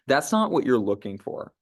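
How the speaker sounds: ordinary delivery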